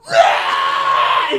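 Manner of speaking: a loud, low, breathy heave of vomiting; loud retching noise. Retold or imitated. Imitating the boss puking into a planter, loud retch. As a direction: bark out a retch like throwing up.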